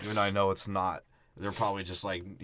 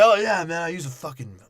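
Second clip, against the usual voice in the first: imitated Italian man; 9 semitones higher, 10 dB louder than usual. Imitating Italian men bragging.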